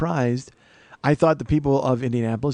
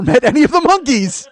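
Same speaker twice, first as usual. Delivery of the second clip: loud, higher mock-expert boasting with a laugh; 7 semitones higher, 6 dB louder than usual. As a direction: puff yourself up as the fake authority. Self-mocking boast as expert.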